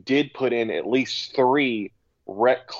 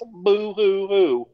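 slow, high fake sobbing; mock crying; 7 semitones higher, 4 dB louder, much slower than usual. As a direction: taunt like a crybaby. Mocking referee complaint as crying.

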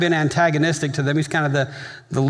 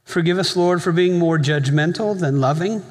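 closing prayer. Acted. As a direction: pray over the congregation with long pauses. Leads the congregation in prayer.

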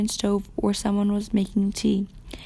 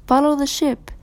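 distant call; 5 semitones higher, 6 dB louder than usual. A narrator's reading. Voice calling from far away.

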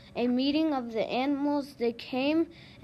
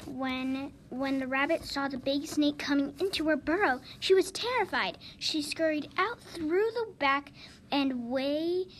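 ordinary delivery, nothing marked

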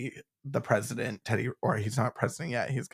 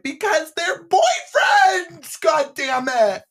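much higher, loud, slow outburst; exasperated shout; 10 dB louder, much slower than usual. Retold or imitated. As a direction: yell it in mock frustration. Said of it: "you're like".

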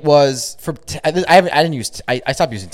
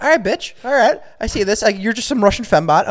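higher-pitched mock challenge; retold knowing retort; 7 semitones higher than usual. Telling friend how he answered the suspected bot, mock challenge. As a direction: play up being onto someone, pitched up.

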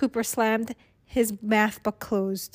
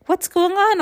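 high, loud startled asking; alarmed question; 9 semitones higher, 5 dB louder than usual. A narrator's reading. Missus Stoker asks the security guard, alarmed question. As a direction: demand to know, startled.

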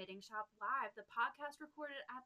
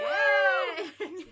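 excited cheer; 17 dB louder than usual. Real, not acted. Show opening cheer.

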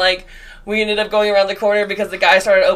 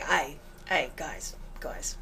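put-on tentative, quiet try at an English accent; imitated English accent; 3 semitones lower, 13 dB quieter, much slower than usual. Retelling an English stranger's greeting in the elevator, tentative English accent. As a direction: softly mimic an English guy's accent. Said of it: "You gotta say it like he would say it".

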